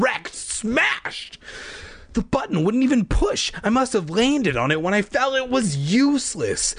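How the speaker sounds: high, panicked narration; rising panic. Acted. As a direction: voice climbing in alarm. Discovers the beeper is broken.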